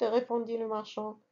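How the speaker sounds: ordinary delivery, nothing marked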